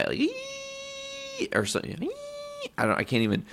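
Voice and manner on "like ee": extremely high, soft imitated ringing tone; piercing high ee. Shown as a demonstration. Showing friends how high the ear ringing was, piercing soft ee. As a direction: squeeze out a tiny shrill ringing. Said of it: "like, on the high".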